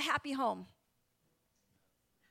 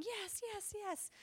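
light mock child's yes, higher and much softer; imitating excited child; 8 semitones higher, 10 dB quieter, slower than usual. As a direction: voice a little girl cheering softly. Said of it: "Abby's going".

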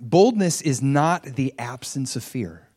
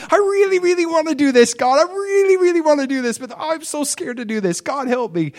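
much higher, louder anxious plea; torn pleading; 14 semitones higher, 3 dB louder than usual. Retold or imitated. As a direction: pray torn between wanting and fear. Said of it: "conflicting emotions".

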